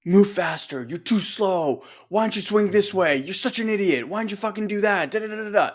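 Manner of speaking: berating inner critic. Retold or imitated. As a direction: scold yourself like a merciless coach. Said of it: "I had this voice constantly berating me".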